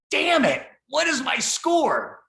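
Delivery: high, loud retold frustration, drawn out; exasperated inner outburst. Part of me demanding my test score, loudly exasperated. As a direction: blurt out the exasperation, stretching the question.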